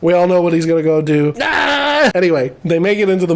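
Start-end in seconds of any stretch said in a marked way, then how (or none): 1.4-2.1 high short yelp; a jokey little shout; a playful yelp at the guard's plan; mock yelp.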